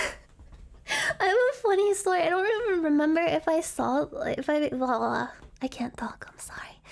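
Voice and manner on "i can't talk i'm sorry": whispering. Real, not acted. Giggling, whispers an apology.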